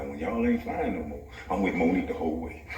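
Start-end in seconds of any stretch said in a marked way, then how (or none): 1.5-2.8 laughing. Real, not acted. Laughing while finishing the joke.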